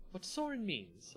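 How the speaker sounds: high, slow, bright smoothing over; cheerfully cutting in; lightly bright hostess tone. A narrator's reading. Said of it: "Olivia said brightly".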